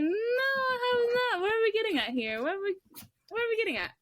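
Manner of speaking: high-pitched retold exasperation; exasperated. Questioning a customer who keeps asking about Bleach, exasperated.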